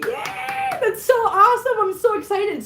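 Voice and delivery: high, bursting yay of delight; excited. Real, not acted. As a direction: cheer for her good news. Reacting to viewer's volunteering news.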